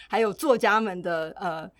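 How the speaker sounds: ordinary delivery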